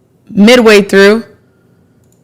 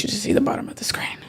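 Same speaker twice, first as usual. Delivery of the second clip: whisper; 16 dB quieter, faster than usual. Real, not acted. Urging viewers to look.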